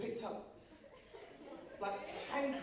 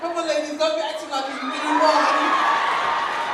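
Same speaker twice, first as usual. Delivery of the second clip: louder, slow drawn-out call to the ladies; loud teasing call; 5 semitones higher, 15 dB louder, slower than usual. Real, not acted. Addressing audience loudly.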